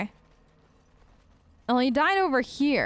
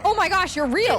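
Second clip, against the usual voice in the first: higher and louder, thrilled disbelief; excited shout; 5 semitones higher, 4 dB louder than usual. Real, not acted. Player amazed the summoned spirit actually appeared, excited shout. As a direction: yelp with delight that it worked.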